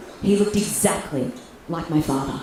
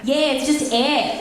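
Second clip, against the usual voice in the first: slow, higher-pitched echo; mocking agreement; 6 semitones higher, slower than usual. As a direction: draw it out in cheeky agreement. She gleefully echoes his excuse.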